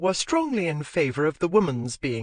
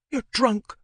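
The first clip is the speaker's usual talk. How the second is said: higher, sharp scornful jab; scornful retort; 8 semitones higher than usual. Acted. Wife retorting to husband, scornful. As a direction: throw it back at him. Quarrel in corridor.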